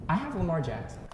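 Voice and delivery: ordinary talk, nothing marked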